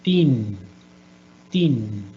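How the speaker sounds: put-on teen said long, with a falling close; stressed teen. Shown as a demonstration. Teacher stressing teen for the learners. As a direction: model the word teen slowly for the class. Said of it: "we are going to use this word".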